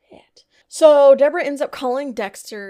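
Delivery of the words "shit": hushed curse under the breath; a startled whispered curse. Real, not acted. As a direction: whisper it like you just saw something bad. Whispered curse after gasp.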